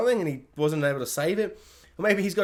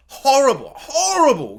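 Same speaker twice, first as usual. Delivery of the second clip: loud dismay; 8 semitones higher, 7 dB louder, slower than usual. Real, not acted. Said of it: "reacting to Allison's loss".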